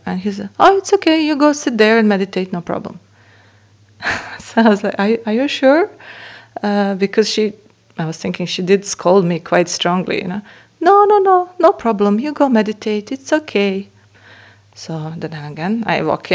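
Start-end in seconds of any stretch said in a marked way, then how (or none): 0.6-3.0 high, bright-toned easy reassurance, quick; reassuring cleaner. Retold or imitated. 5.0-5.9 unsure question. Retold or imitated. Narrator doubting permission. 10.8-13.9 high, eager no-no-no brushing off the worry; reassuring cleaner. Retold or imitated.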